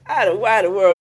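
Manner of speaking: high, loud, laughing imitation of baffled viewers; mock bewilderment. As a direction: put on a loud, high-pitched puzzled viewer. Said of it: "I know y'all probably like".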